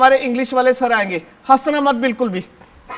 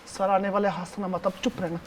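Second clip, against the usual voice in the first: much softer, hushed order to stay quiet; hushed warning; 5 semitones lower, 11 dB quieter than usual. Acted. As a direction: hush them as the teacher nears. Teacher about to arrive, hushing.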